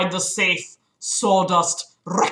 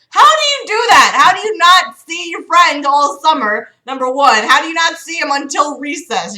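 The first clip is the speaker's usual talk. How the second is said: incredulous rant; 6 semitones higher, 5 dB louder than usual. Real, not acted. Ranting at the writer.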